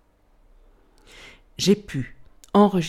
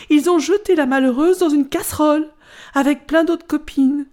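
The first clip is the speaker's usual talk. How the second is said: much higher and louder, scandalized; dramatic telling; 9 semitones higher, 7 dB louder than usual. Acted. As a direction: pile on the horror of it. Golden apple tells the cooking.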